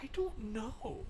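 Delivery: slow, very soft whisper. Pretend. Streamer wondering if a game still exists, slow whisper. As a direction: whisper it, drawing out the words. Playful whispered answer to viewer.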